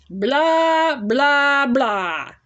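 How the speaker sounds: high mocking blah blah blah falling away; sing-song mock dismissal; dismissive blah. Real, not acted. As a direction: brush it off with scorn. Dismissing the moralizing voice.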